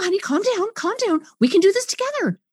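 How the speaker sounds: high, sugary soothing; cheerleader fix-it mode. Retold or imitated. Fix-it parent soothing the child, sugary high. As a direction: coax them along, overly chipper. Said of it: "cheerleader fix-it mode".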